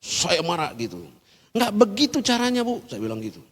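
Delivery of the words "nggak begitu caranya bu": retold semi-snap. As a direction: act out a half-shouted telling-off. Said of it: "rada membentak". (agak membentak, diperagakan)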